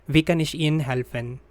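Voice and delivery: Wie kann ich Ihnen helfen said quickly, stress on Wie; natural speed. Shown as a demonstration. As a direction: rattle it off like a native. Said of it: "phrase said at natural speed first". (quick native-speed read)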